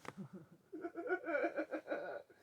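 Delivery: high-pitched burst of laughter; real high giggling fit; laughing. Real, not acted. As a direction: break up laughing. Laughs at own mimicry.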